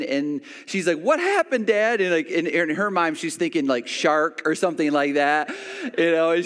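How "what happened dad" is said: high-pitched imitation of a worried child; acted child's panic, pitched way up; alarmed daughter. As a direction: cry out to Dad in mock alarm. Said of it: "she saw me in pain".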